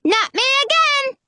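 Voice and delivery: put-on very high squeaky little boy; squealed child's protest; squeaky cry. A narrator's reading. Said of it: "he cries".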